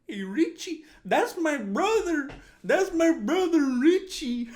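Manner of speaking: very high, loud exaggerated brother voice; hammed-up movie-character holler; Bob from La Bamba. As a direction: call out proudly in a cartoonish, stretched high voice. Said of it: "Remember how he talked like that?".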